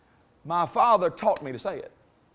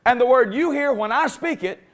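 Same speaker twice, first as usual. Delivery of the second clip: louder, driving, rising preacher cadence; full-force preaching; 6 semitones higher, 6 dB louder than usual. Real, not acted. Preacher to congregation, loud rising full-force preaching. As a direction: build the sermon to a loud peak.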